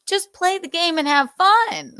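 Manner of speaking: high-pitched pleading retort; mock exasperation. Host answering the upset gamer, light mock exasperation. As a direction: plead it high, half laughing.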